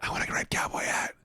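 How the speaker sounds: breathy secret whisper. Real, not acted. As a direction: whisper it to yourself. Unvoiced aside.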